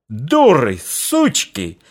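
furious bark of abuse; frenzied yell. A narrator's reading. Old father roars at the women in a frenzy, furious yell. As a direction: roar at the women. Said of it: "иступлённо заорал".

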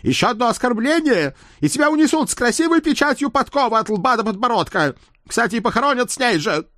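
high, menacing horse voice; threaten, quickening at the end; threatening talking horse. Acted.

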